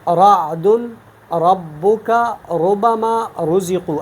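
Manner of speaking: رعد ربک ربما رزقوا with a thick, heavy ر, slow; slow tajweed demo of heavy ر. Shown as a demonstration. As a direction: recite ربما slowly, ر full and velarized. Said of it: "ان کی مثالیں یہ ہے".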